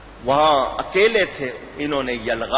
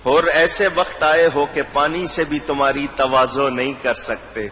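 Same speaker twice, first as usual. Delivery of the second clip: usual speaking voice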